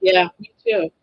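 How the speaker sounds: usual speaking voice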